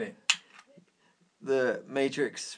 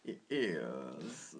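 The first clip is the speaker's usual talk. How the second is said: posh voice; 6 semitones lower, 6 dB quieter than usual. Pretend. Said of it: "in a very posh voice".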